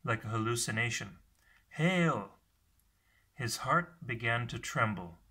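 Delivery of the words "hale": high, loud distant hail; calling out. A narrator's reading. A panting voice hails him from out of sight, calling out. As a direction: call out from afar, higher and louder. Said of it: "Then a panting voice called".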